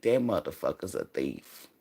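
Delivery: very low, hushed verdict; quiet realisation. Retold or imitated. Speaker recalls sensing the energy of a thief, quiet realisation. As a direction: drop right down, as if to yourself.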